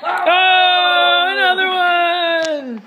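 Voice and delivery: high, loud and stretched out; excited. Real, not acted. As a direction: cry out in amazement. Another goal scored at air hockey.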